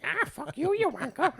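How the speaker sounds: high-pitched cartoonish British insult; mock British accent. Co-host giving a message from Earth, high mock British accent. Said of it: "I do a bad accent".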